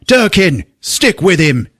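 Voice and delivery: high, loud order; yelling out. Retold or imitated. Chief ordering Durkin after Stone, yelled. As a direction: holler it like a police chief. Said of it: "The chief yells out".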